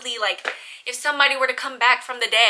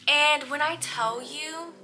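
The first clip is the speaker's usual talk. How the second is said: slow build-up; 5 semitones higher, slower than usual. Real, not acted. Building up to chills.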